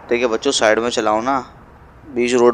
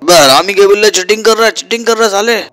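high, loud furious accusation; angry shout; 8 semitones higher, 5 dB louder than usual. Acted. Accusing the rival of cheating in the race, furious loud shout. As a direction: yell cheating at him.

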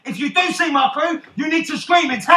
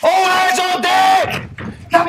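long drawn-out, raised shout; captain's order; 5 semitones higher, much slower than usual. Acted. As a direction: bellow slowly across the deck. Captain orders crew to work.

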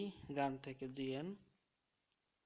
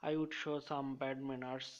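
very slow, word by word; slow dictation; much slower than usual. Shown as a demonstration. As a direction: dictate it slowly so students can memorize it. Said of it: "একটা sentence দিয়ে মনে রাখবো".

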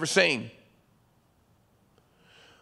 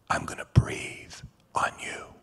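breathy whisper, intimate; whispered promise; 5 dB quieter than usual. Acted. Jesus promising new breath to a grieving people, whispered. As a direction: breathe the words onto the listener.

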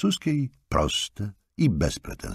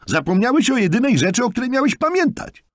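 scolding; 6 dB louder, faster than usual. A narrator's reading. Jonathan scolds Markus.

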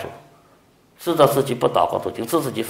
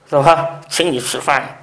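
normal talking voice

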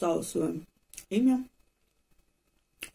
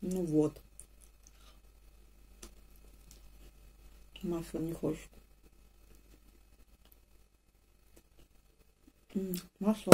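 talking while eating rusk; chewing between words; mouth full chewing; 5 dB quieter than usual. Real, not acted. Seen 1: Bites and chews a brown piece through the labelled stretch.

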